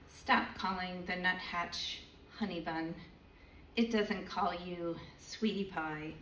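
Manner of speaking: measured spoken-word poem, deliberate pauses; poetry reading. Acted. Poet reads to the event audience, measured poetry reading. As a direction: let each line land like a live poem.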